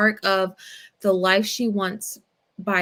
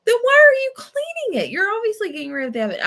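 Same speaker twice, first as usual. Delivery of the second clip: much higher, louder, then rattled off fast; indignant accusation; 14 semitones higher, 6 dB louder, faster than usual. Retold or imitated. Retelling a parking lot fight, fast and outraged. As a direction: she catches him wiping her car and calls it out angrily. Accusing man of hiding evidence.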